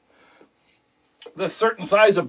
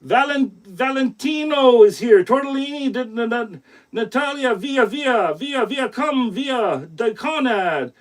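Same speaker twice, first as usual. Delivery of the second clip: hammed-up Italian accent, higher, rolling the Italian names; a light, joking Italian accent to welcome Valentino; mock Italian accent; 5 semitones higher, slower than usual. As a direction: playfully put on an Italian accent to greet the fans. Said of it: "I'm sorry about the accent".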